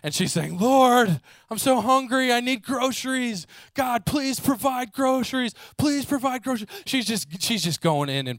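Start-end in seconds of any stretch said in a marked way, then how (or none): 0.6-6.7 much higher, loud pleading cry to God; passionate loud prayer. Retold or imitated. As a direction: cry out to the Lord in desperation. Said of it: "louder and more passionately than ever before".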